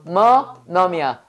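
얼루머넘 broken into separate syllables. Shown as a demonstration. Teacher breaking down aluminum's sounds, syllable by syllable. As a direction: spell out 얼루머넘 as eol, lu, meo, neom. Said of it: "다시 해봐".

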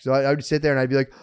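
ordinary delivery

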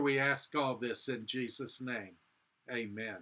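usual speaking voice

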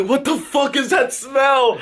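high, slow mock shout; hammed-up cry of disgust; imitated outburst. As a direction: act out someone's disgusted outburst. Acts out friend's imagined outburst.

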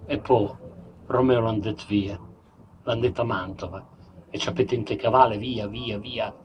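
usual speaking voice

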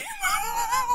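high shrieking laugh after a scare. Real, not acted. Scream-laugh after crash.

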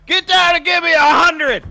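barked boot camp order; drill sergeant. Retold or imitated. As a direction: shout it like a drill instructor. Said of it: "he's acting like a drill instructor".

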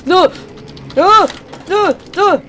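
high loud scream of no; panicked screaming. Real, not acted. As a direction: shriek in real panic. Enemy appears, screaming no.

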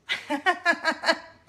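high, loud burst of laughter; real rippling giggle; amused laugh. Real, not acted. Mom tickled by her daughter's question, laughing.

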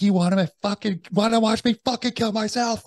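very high-pitched retold scream. Husband to wife with a gun to his head, screaming. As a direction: re-enact a frantic, strained scream at your wife. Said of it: "I'm fucking screaming".

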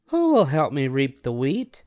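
a hen politely asking a neighbour; Little Red Hen. A narrator's reading. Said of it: "she asked".

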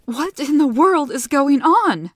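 high, loud alarmed scream. A narrator's reading. Gianna screaming at the sudden chaos. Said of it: "Gianna screamed".

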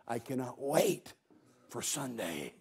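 slow, low and soft; hushed anticipation. Acted. Preacher voicing Mary waiting for Sunday, hushed and slow. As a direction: say it hushed and measured, with a pause before the end.

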